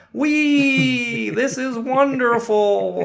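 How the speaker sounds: high, loud, drawn-out stilted cheer; stilted mock excitement at full volume; stilted Gus. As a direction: stretch out a stiff, high whoop of delight. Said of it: "like, this real stilted way".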